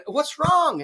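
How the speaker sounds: high called-out question; calling out concerned. Retold or imitated. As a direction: shout through the outhouse door. Narrator calls to man inside.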